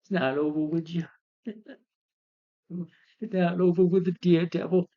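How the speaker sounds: high-pitched mock villain gloat; hammed-up laughing threat; laughing villain impression. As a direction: taunt through an imitated villain's laugh. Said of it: "And he's laughing. He's like this".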